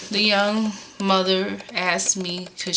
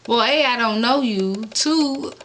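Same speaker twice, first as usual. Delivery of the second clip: exasperated rant; 6 semitones higher, 4 dB louder than usual. Retold or imitated. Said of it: "I'm like".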